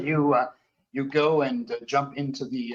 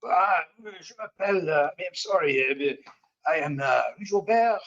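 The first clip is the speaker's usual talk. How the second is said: higher-voiced French Canadian, hesitant English; French Canadian accent; 6 semitones higher than usual. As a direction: introduce yourself haltingly in a French Canadian accent. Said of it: "French Canadian, uh, voice".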